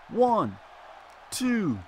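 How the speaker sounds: rising loud count; excited pin count. Real, not acted. Commentator counting the pin, rising excitement.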